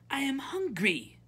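high, loud, demanding princess; imperious princess, pitched high. A narrator's reading. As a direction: announce it like an entitled princess. Princess in the story.

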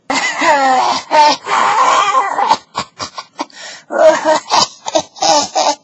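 long wheezy comic cackle; drawn-out put-on chuckle; hammed-up laugh. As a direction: laugh in bursts, in character.

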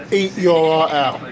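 ordinary talk, nothing marked

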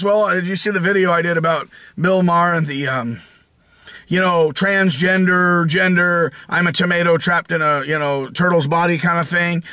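ordinary talk

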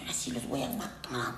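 low, very soft mock-furious threat; muttered angry threat. Witch imagines herself furious, mutters her threat low and soft. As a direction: growl it under the breath like a vengeful witch. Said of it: "Imagínate que estés toda".

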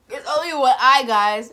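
shouted high and loud in disbelief; excited shout. Real, not acted. Reacting to a music video, shouting in shock. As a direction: yell it out, stunned.

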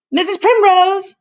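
high, loud call of Missus Primrose; shouted summons to the housekeeper; yelled name. A narrator's reading. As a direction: call across the house to her. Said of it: "he yelled".

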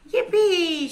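high-pitched cheer. A narrator's reading. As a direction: whoop it out high, in celebration. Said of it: "cheered the reindeer".

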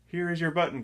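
high, eager call of discovery; excited cry. A narrator's reading. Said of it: "cried Frog".

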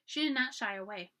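ordinary delivery, nothing marked

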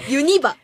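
「ユニバ」を関西弁の抑揚で手本として言う; 関西のイントネーションで「ユニバ」; 関西弁の発音. Shown as a demonstration. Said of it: "全然その区別がわからない". (関西弁の抑揚で「ユニバ」)

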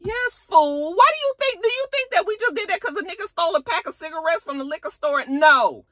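high-pitched indignant rant; exasperated. Retold or imitated. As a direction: berate in high, incredulous disbelief. Acted quarrel, son rebuking mother.

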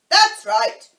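high, very loud approval; shout. A narrator's reading. Queen approves the soldiers' report, shouting. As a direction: shout it back sharply. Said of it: "shouted the Queen".

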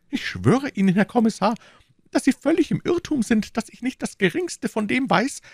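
high, earnest frightened protest; protesting shopkeeper. Acted. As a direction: swear your innocence in a high voice. Bonacieux swearing innocence.

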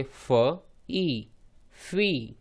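फ, then ई, then blended फी; sounding out. Shown as a demonstration. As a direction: blend फ and ई into फी step by step. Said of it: "तो यदि साथ में पढ़ेंगे".

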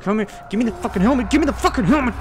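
fast, loud and high demanding shout; frantic angry yell. Real, not acted. Player chasing the monster for his helmet, frantic angry yell. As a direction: scream at the thief to hand it back.

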